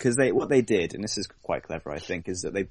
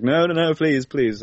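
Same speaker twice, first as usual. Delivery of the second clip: higher, louder hammed-up begging; mock pleading; 7 semitones higher, 7 dB louder than usual. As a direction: beg them not to go there, half-joking. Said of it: "It's like".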